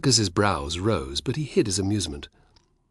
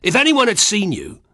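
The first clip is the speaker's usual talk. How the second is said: roared, much higher and louder; roaring; 10 semitones higher, 6 dB louder than usual. A narrator's reading. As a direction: roar it in fury. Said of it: "roared Castus".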